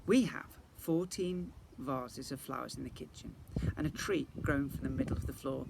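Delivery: plain reading voice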